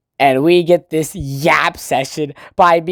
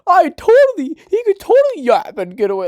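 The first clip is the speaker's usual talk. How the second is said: much higher, louder falsetto whine; mock Kazuya; 12 semitones higher, 4 dB louder than usual. Mocking Kazuya whining about being Umi. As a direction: squeal it like a jealous boy. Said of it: "But then Kazuya, dude, this".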